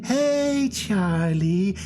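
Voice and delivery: very high, slow, singsong unicorn. Acted. Streamer voicing the unicorns calling Charlie, high and singsong. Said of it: "the other two unicorns".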